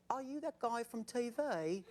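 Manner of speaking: slow, put-on Geico gecko accent; Geico lizard accent. Pop star spotting him from the stage, mock Geico lizard accent. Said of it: "Is that, like, the Geico lizard voice?".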